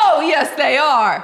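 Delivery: high, loud contradiction; loud insistence. Real, not acted. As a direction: insist loudly and playfully. Heated mock argument.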